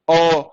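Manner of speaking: ओ held slow and long; long vowel. Shown as a demonstration. Teacher demonstrating the vowel's length, drawn-out ओ. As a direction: let ओ run on. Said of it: "काफी लंबी जा रही है".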